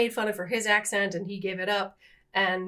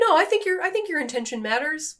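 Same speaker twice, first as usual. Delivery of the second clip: higher-pitched mock reassurance; imitated reassuring husband; 6 semitones higher, 4 dB louder than usual. Wife imitating husband defending good intentions, higher mock reassurance. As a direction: imitate him insisting, pitch up.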